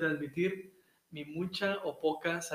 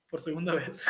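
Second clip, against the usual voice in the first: laughing; 9 dB louder than usual. Real, not acted. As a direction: trail off into laughter. Ends laughing.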